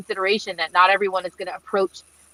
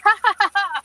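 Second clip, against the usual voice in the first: high, hammed-up giggling laugh, a little louder; mock giggle; 13 semitones higher, 4 dB louder than usual. Cadet laughing off yelling, high mock giggle. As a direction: play the cadet who giggles at everything. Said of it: "I was laughing".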